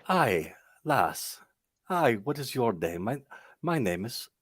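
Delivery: a tentative Irish lilt; Irish accent. Acted. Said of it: "I'm trying to do an Irish accent".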